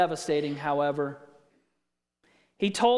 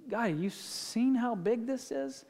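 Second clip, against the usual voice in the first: softer, a small scared plea to God; hushed fearful prayer; 4 semitones higher, 6 dB quieter than usual. Acted. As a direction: whisper your doubt up at the towering water. Afraid prayer before huge sea.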